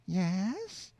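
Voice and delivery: Yes? lifted high with a rising end; rising inflection. Acted. Woman on the phone asks with a high, rising "Yes?". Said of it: "rising inflection".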